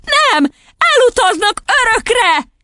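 very high, drawn-out wail; squealed, emphatic exclamation; child's cry. A narrator's reading. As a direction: cry it out as a desperate little girl. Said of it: "kiáltotta Matilda".

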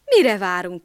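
loud eager cry; dog loudly calling to leave now. A narrator's reading. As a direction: shout it out, ready to go. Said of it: "kiáltotta".

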